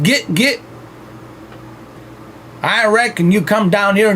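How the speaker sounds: hollering like a Southern backwoods daddy; Southern accent. Pretend. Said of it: "[name] Southern accent".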